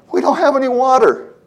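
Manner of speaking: high, loud, panicked people with no water; panicked cry. Acted. Preacher voicing Israelites crying out in panic, high and loud. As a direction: cry out in alarm as if stranded in the desert.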